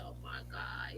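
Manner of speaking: breathless whisper, barely voiced; stunned whisper. Daughter hearing her mother's outburst, whispering in shock. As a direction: let it slip out in shock.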